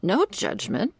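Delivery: airy, playful "no judgment" leaping high and rising; teasing. Acted. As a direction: toss it off lightly, mock-innocent. Teasing reassurance after a jab.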